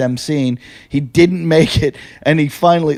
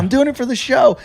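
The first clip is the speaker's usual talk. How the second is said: high-pitched self-justifying insistence; high, defensive repeat of the excuse; pleading excuse; 9 semitones higher than usual. Acted. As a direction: protest it in a much higher voice. Insisting excuse to a hostile crowd.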